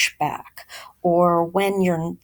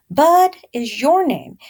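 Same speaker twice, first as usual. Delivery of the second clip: louder, higher motherly insistence; firm mother; 7 semitones higher, 5 dB louder than usual. Acted. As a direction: lay down the law like a mama. Mother voiced in story.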